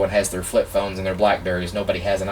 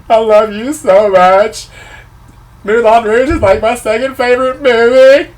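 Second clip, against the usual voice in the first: much higher, louder, slow squealing gush; starstruck fan voice; 13 semitones higher, 11 dB louder than usual. Retold or imitated. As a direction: gush at your idol like a starstruck fangirl. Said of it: "I can feel myself talking like this".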